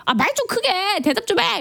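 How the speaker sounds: high-pitched exasperated sister; imitated nagging. Acting out nagging her mumbling brother.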